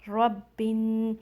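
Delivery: रब्बिन drawn out, ब flowing into न; slow, joined रब्बिन; slow joined recitation. Shown as a demonstration. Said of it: "बा को आपने नून में मिलाना है".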